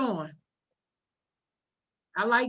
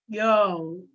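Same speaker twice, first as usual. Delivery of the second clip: ordinary talk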